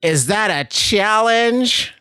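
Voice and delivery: high, slow mock challenge; drawn-out high dare; drunk lady quote. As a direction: imitate the tipsy lady daring him. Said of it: "and she goes".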